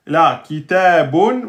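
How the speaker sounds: kitabun said slowly with a clear final n; slow careful Arabic. Shown as a demonstration. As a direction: spell out kitabun syllable by syllable. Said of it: "vous entendez quoi?".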